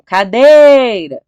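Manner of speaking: "cadeira" shouted as if at the gate, DEI stretched long; calling out loudly. Shown as a demonstration. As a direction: call "cadeira" out, dragging the "dei" high and loud. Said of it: "chama a cadeira, alto no portão". (chamando "cadeira" alto no portão, com o "dei" bem esticado)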